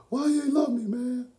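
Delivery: high, loud wail of hurt; comically wounded outburst; mock anguished plea. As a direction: cry it out like a hammed-up sitcom heartbreak. Quotes tearful Fresh Prince line.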